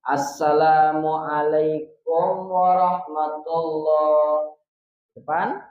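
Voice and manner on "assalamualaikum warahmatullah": Assalamualaikum warahmatullah drawn out slowly and smoothly; slow perfect salam demo. Shown as a demonstration. Teacher models the perfect prayer salam slowly. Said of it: "yang agak sempurna salam itu".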